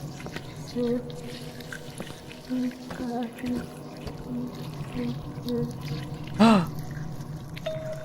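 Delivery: sudden breathy gasp of fright; sharp gasp. Real, not acted. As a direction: gasp in shock. Horror game shock.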